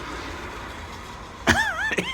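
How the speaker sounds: high, wheezy giggle; real laughter. Real, not acted. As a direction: crack up mid-story. Cracks up at his own story.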